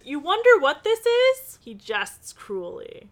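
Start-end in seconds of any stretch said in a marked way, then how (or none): 0.0-1.6 high, loud gloating taunt; gloating villain. Acted. Villain shows Nancy the spider, loud high gloating taunt. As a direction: sneer it like a villain showing off his weapon.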